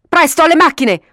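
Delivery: high, loud urgent order; sudden shouted command; shouting. A narrator's reading. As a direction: yell for everyone to run. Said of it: "urlò Jacques".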